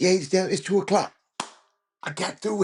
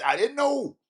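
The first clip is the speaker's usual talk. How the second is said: surprised exclamation; 11 semitones higher than usual. Real, not acted. Surprised reaction to reading.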